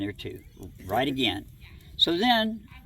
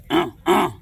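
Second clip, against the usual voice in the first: louder guttural double grunt; imitated grunt; 3 semitones higher, 6 dB louder than usual. The big one calling the little one away, a loud grunt. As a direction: bark two short throaty grunts. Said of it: "I hear the".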